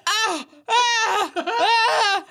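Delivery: high-pitched mock wailing, broken by laughter; imitated screams. Podcaster mimicking the woman rolling around the pit, imitated screams. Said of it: "she was like, rolling around the pit and she was like".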